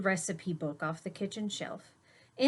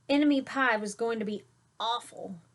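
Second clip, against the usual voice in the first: plain reading voice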